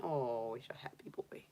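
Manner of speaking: soft whisper to the bunny. Real, not acted. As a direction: breathe it softly so he stays calm. Cooing softly to the rabbit.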